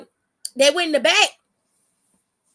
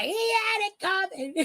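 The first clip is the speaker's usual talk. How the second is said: 1 high mocking singsong; 8 semitones higher, 5 dB quieter than usual. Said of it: "I was like".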